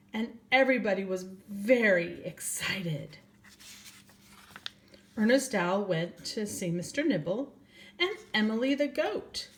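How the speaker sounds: gentle storybook reading voice; reading to children. A narrator's reading. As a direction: read it like bedtime story time for little ones. Reads a picture book aloud.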